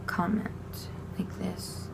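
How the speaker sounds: soft whispered demo; whispering the word. Shown as a demonstration. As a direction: breathe the word out, no voice. Whispered sign-name demo.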